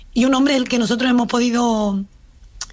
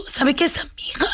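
whispered, higher, in disbelief; mock-urgent whisper, higher; retold hushed protest; 4 semitones higher than usual. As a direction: hiss the question under the breath. Sister confronting man photographing niece.

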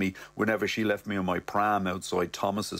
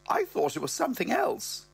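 higher mother voice; mother's voice; 14 semitones higher than usual. Retold or imitated. Mother unsure of the wife's name, higher voice. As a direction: say it as the mum, higher. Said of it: "She goes".

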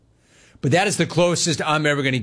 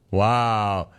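ordinary talk

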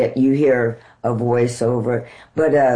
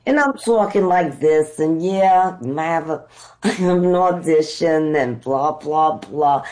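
put-on New York accent. Actress testing her New York accent on a cab driver. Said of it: "I'm trying my New York accent out".

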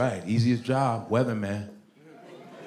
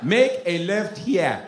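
put-on slow, higher Nigerian-accented directions; Nigerian GPS; 4 semitones higher, 3 dB louder, much slower than usual. Nigerian GPS giving directions, slow Nigerian accent. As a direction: instruct slowly in a Nigerian accent. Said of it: "You couldn't have a Nigerian on a G P S".

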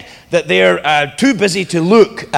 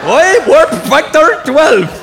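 higher, louder garbled Scottish impression; mock Scotty accent; 9 semitones higher, 6 dB louder, slower than usual. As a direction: parody Scotty as incomprehensible. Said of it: "what does he say".

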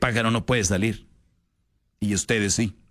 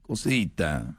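mock tender husband; 4 semitones lower, 4 dB quieter, much slower than usual. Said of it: "Y dices tú".

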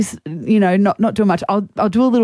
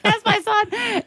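high, slow, softer gushing "That's my son"; drawn-out swoon of a proud mum; proud mum cheer; 11 semitones higher, 4 dB quieter, slower than usual. Retold or imitated. Mum cheering her son's goal, in a high slow swoon. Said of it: "I was like".

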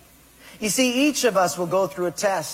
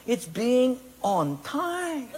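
slow punchline; 4 semitones higher, much slower than usual. Acted. Punchline delivered slowly.

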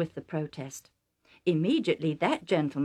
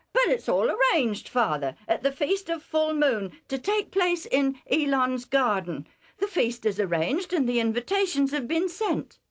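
higher, louder pleading son; protesting son; 8 semitones higher, 5 dB louder than usual. Acted. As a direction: insist it's already settled. Esau protests to father.

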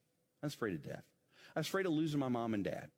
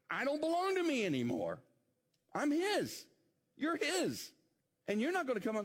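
climactic fervor; 10 semitones higher than usual. Acted. Climactic preaching rise.

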